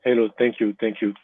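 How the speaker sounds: normal talking voice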